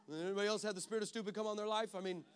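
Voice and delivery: ordinary talk, nothing marked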